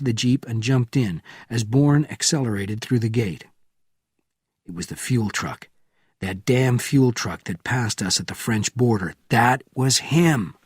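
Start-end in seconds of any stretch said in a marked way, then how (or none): none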